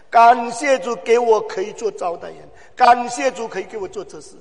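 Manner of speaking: preacher chanting thanks to the Lord; fervent preaching litany. Acted. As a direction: drive each 感谢主 home.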